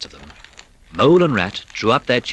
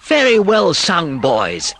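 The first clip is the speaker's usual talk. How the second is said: louder, slow, hearty cheer; hearty praise; 7 semitones higher, 6 dB louder, slower than usual. A narrator's reading. Rat to the carol singers, hearty and loud. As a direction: praise the little singers warmly. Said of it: "cried the Rat heartily".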